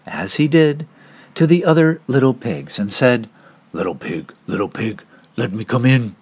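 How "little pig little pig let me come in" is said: low, coaxing wolf voice. Acted. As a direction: coax the pig in low.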